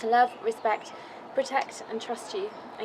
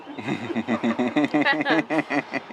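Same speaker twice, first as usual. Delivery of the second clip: long shaky laugh, close to tears; teary nervous laugh; 9 semitones lower, 6 dB louder than usual. Real, not acted. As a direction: laugh through the nerves as the tears come. Bride overcome while reading vows.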